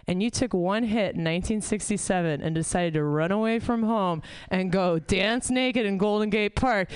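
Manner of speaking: normal talking voice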